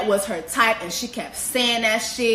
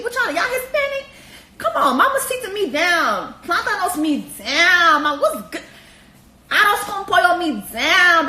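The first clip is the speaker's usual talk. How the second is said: excited; 6 semitones higher, 4 dB louder than usual. Pretend. Eager dating-another-culture bit.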